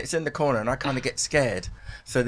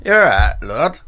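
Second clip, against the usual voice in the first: mock northern English bloke, louder than usual; northern geezer accent; 3 semitones higher, 7 dB louder, slower than usual. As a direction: put on a northern English geezer answering the phone. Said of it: "this northern geezer and he'll say to me".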